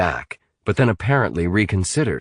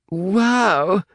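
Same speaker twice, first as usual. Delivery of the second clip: pained; 12 semitones higher than usual. A narrator's reading. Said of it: "said Ian painfully".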